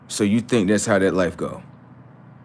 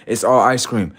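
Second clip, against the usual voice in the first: normal talking voice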